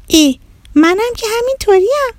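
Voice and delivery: put-on very high, pleased child's voice; delighted little girl. A narrator's reading. Girl finding she is just like the islanders, delighted. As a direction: exclaim high, like a girl who recognises herself.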